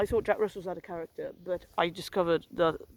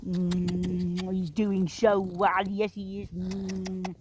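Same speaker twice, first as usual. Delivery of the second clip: cooing to the dog; much slower than usual. Real, not acted. Owner praising trusty Rusty, doting pet talk. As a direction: coo praise at a pet, stretching the words.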